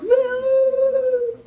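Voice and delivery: high, piercing yelled no; imitated wild scream. Imitating the schizophrenic man on the corner, screaming no. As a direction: shriek it like a man off his meds. Voicing the schizophrenic man's outburst.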